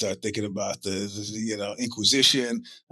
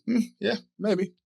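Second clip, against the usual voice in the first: mocking clerk; 9 semitones higher than usual. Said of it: "The guy's like".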